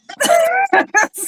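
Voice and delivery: high-pitched burst of laughter; real laugh. Real, not acted. Teasing that you might not like what she says, real laugh. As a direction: break up laughing, high and helpless.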